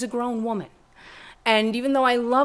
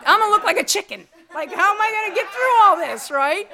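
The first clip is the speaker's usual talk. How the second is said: higher, louder and fast; hammed-up panic, much higher and louder; mock panic; 12 semitones higher, 8 dB louder than usual. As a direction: spiral into comic alarm. Panic over chicken neck.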